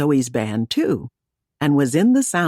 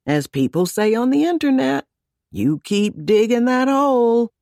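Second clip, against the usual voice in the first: sarcastic; 9 semitones higher than usual. A narrator's reading. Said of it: "Wyatt advised sarcastically".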